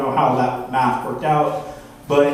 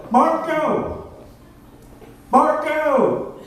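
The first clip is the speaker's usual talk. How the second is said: high, sung-out Marco Polo call; mock searching call, high and drawn out; 11 semitones higher, much slower than usual. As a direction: call out blindly across the room, pitched way up. Calling out in Marco Polo game.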